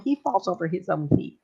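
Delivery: normal talking voice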